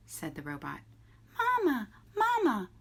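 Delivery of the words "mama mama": very high, loud little gosling cry; hatchling cry. A narrator's reading. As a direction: squeak it out like a tiny hungry chick. Hungry hatchling calls for mother.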